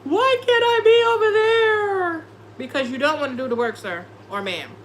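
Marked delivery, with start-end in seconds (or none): 0.0-2.2 much higher, louder whining, near crying; mock whiny envy. Reader mocking the envious ex, whining high and loud. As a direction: whine like a jealous ex on the outside. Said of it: "and they like".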